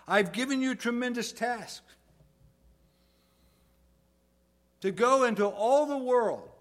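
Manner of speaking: high, loud, forceful commissioning; Jesus. Acted. Jesus handing his followers a great task, proclaimed loud and high. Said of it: "what Jesus is saying".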